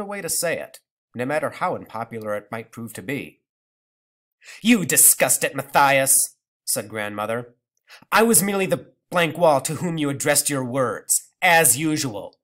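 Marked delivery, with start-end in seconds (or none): 4.6-6.4 loud, cutting reproach; bitter retort. Acted. Grandmother retorts. 8.1-12.4 put-on loud, scornful old woman; bitter. Grandmother bitter.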